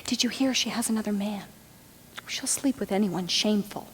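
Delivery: hushed, quick village gossip; gossiping whispers. Acted. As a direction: pass on scandal under your breath. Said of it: "I hear your whispers".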